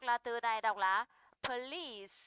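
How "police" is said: "police" stressed on LICE; second-syllable stress. Shown as a demonstration. Teacher reads out example word, stress on second syllable. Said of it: "trọng âm sẽ rơi vào âm".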